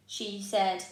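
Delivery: ordinary delivery, nothing marked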